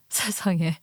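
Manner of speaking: a breathless gasped whisper; stunned whisper. Acted. Wife whispering in shock at her husband's secret. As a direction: let the shock steal your voice.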